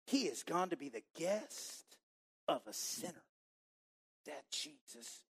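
soft, grumbling mutter under the breath; soft muttering crowd, disapproving. Pretend. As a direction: grumble it so the next person hears. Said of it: "began to mutter".

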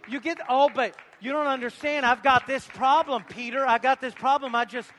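shouted scripture, high and loud; loud preacher quoting scripture; preaching at full force. Acted. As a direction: thunder it out to the congregation. Climax of sermon, shouted preaching.

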